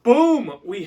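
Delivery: loud, high excited "Boom!"; excited shout. Real, not acted. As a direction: burst out with a big reveal. Excited reveal of new toy.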